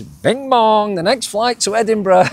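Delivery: high sing-song airport announcement; mock flight call over the speakers; tannoy announcer. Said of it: "over the tannoy".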